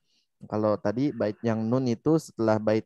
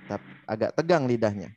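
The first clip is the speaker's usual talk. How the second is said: normal talking voice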